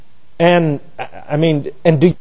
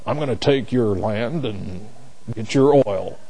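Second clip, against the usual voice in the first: movie villain impression; 5 semitones lower, 8 dB quieter than usual. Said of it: "sound like that guy in Will, Will There Be Blood?".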